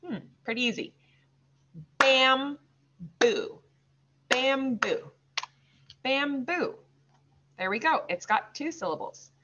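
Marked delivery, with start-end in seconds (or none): 2.0-3.6 "Bamboo" with a long gap between syllables; bam, pause, boo, each held; widely separated beats of "Bamboo"; slow, broken up. Shown as a demonstration. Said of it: "then we'll say it slow". 4.3-6.7 "Bamboo" in two clear beats; stretched, even teaching "Bamboo"; slow. Shown as a demonstration. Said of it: "then we'll say it slow".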